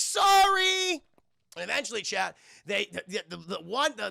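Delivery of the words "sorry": high, loud, stretched mock apology; Aang calling out. Boy apologizing while crashing through, high mock yell. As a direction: sing it out as you hurtle past. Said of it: "Aang's like".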